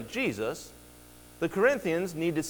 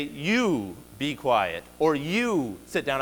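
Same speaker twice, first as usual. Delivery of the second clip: "You" drawn out and stressed, both commands spoken very slowly; single out the student with every word; emphatic you; 2 semitones lower, much slower than usual. Shown as a demonstration. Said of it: "I'm making it emphatic".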